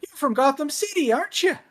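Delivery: mock Irish Batman. Irish-accented Batman asking a stranger, high and lilting. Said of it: "he's like".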